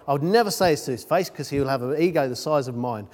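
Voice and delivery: normal talking voice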